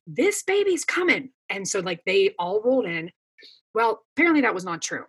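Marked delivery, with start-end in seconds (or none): 0.1-1.3 much higher-pitched imitated yell; retold yell. Mother retelling her yell to the room during labor, mock yell. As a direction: call it out urgently as a retold cry. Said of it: "yelled and I was like".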